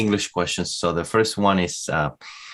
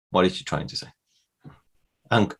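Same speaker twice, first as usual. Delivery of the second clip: usual speaking voice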